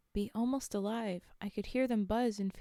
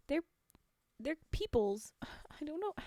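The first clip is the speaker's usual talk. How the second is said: whisper; 6 semitones higher than usual. Real, not acted. Whispered aside.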